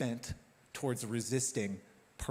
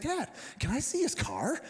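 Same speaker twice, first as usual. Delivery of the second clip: put-on child's voice; 7 semitones higher, 4 dB louder than usual. Four-year-old son voiced in story.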